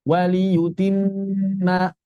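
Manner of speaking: words drawn out in chanted tajwid style; slow recitation demo. Shown as a demonstration. Teacher modelling recitation for students. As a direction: recite each word slowly with held nasals. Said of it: "Jadi ditahan dua harokat".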